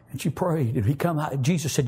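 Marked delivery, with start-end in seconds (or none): none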